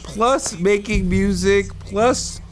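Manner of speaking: high, disbelieving and amazed; incredulous. Real, not acted. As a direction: pitch way up in disbelief. Incredulous rant about [name].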